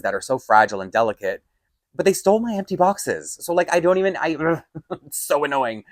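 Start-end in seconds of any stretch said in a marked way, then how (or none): 1.9-5.8 higher, agitated and rushing; getting carried away by the annoyance; worked up. Real, not acted. Telling a friend about the stolen boxes, agitated and high. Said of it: "Okay, now I'm getting really into the story".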